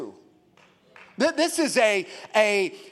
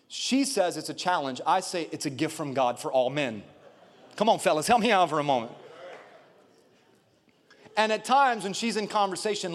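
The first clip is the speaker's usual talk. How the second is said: normal talking voice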